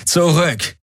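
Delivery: high, sharp order; commanding. A narrator's reading. Guard leader warning off Galen, commanding high voice. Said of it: "befahl Tari".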